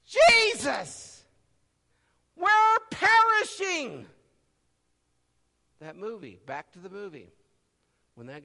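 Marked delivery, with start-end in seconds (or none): 0.0-4.1 high, loud, slow desperate cry; desperate scream. Retold or imitated. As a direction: scream for rescue from the storm. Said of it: "they screamed out".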